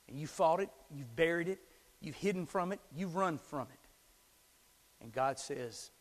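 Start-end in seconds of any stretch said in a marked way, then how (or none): none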